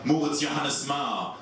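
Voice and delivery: usual speaking voice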